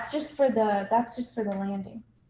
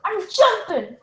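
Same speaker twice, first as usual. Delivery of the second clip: higher, louder announcement; loud declaration; 11 semitones higher, 7 dB louder, slower than usual. Pretend. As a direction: proudly announce the jump. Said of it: "You're jumping".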